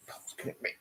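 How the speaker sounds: whispered coaxing to the cat. Real, not acted. Owner whispering to coax Bubbles closer. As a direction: coax her over in a hush.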